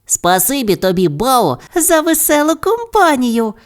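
high, rising character voice; eager high-pitched thanks; chorus of thanks. A narrator's reading. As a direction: thank brightly, climbing very high. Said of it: "хором подякували хоробрі зайці".

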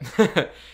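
a quick burst of laughter at herself; short laugh. Real, not acted. Laughing at her own aside about David. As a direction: laugh it off, amused.

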